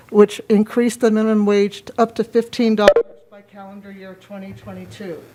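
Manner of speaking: ordinary talk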